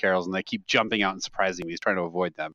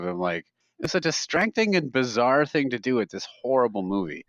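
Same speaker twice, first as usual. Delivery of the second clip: ordinary talk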